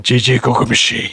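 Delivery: imitated creepy woman, pitched low. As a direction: drop it down like an eerie figure outside. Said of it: "it's, like, in lower".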